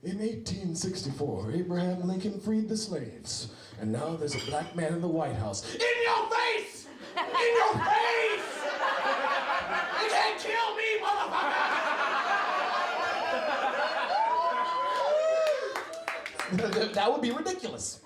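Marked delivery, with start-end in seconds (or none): 0.0-5.6 a lightly voiced solemn PSA announcer. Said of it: "Can you imagine those P S As?". 5.7-11.6 gloating shriek. Pretend. Gloating PSA punchline. 11.7-16.9 shrieking laugh. Pretend.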